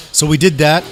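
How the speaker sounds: usual speaking voice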